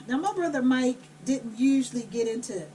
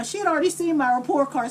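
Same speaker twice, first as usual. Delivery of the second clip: ordinary talk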